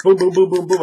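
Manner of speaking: loud, high burst of booms; rapid-fire booms. Shown as a demonstration. Game master miming quick voice switches, punchy burst. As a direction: fire them off like quick hits.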